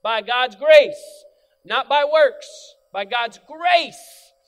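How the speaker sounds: loud, slow, hammered out; full-force slow sermon shout; emphatic preaching. Real, not acted. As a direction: pound each word like a pulpit proclamation. Preacher hammering home grace.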